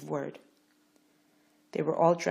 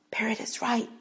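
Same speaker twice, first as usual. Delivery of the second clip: whispered; 7 semitones higher, 4 dB louder than usual. A narrator's reading. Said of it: "Pharaoh heard whispered".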